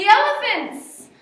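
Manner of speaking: big loud high announcement; excited reveal. A narrator's reading. Teacher reading to children, loudly announcing the elephants. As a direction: boom it out like a grand surprise.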